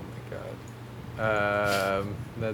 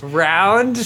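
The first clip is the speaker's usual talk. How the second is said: incredulous; 11 semitones higher, 7 dB louder than usual. Real, not acted. Incredulous at round candy category.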